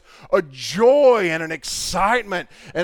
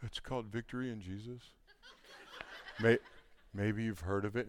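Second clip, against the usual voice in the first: mopey, much softer, with a laugh; Eeyore-like gloom; 9 semitones lower, 17 dB quieter than usual. Retold or imitated. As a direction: say the title like a sigh. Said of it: "it's like Eeyore".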